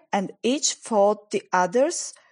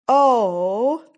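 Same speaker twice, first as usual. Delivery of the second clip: louder, drawn-out "oh"; startled little outburst; sudden exclamation; 2 semitones higher, 5 dB louder than usual. A narrator's reading. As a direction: blurt it out, as if just noticing something. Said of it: "said Susan suddenly".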